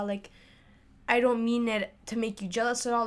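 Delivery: ordinary talk, nothing marked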